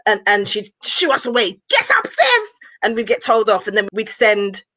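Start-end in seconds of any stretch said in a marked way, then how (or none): 1.7-2.5 high-pitched scolding order; auntie shooing kids. Retold or imitated. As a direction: imitate an auntie sending kids away. Auntie shoos the kids away.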